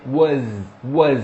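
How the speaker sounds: low "was", short UH and buzzing Z. Shown as a demonstration. Teacher modelling the nicer version, "was" as "wuhz". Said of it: "som de z".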